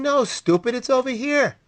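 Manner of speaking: mock exasperated thought; exasperated inner voice. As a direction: urge the player silently, up high. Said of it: "and you're thinking".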